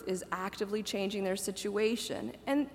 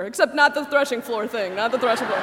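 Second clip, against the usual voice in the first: laughing aside; 7 dB louder, faster than usual. Real, not acted. Laughing aside about Ruth story.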